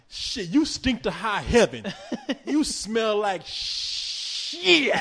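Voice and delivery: very slow, high put-on voice; mock preacher insult. Preacher insulting a caller, slow and high. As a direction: stretch out the insult like a mocking preacher. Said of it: "he's like".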